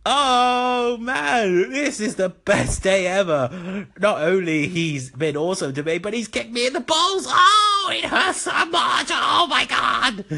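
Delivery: high squealing mock pain; hammed-up pained glee. Retro Dude after the kick, high squealing mock pain. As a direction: wail happily through cartoon pain.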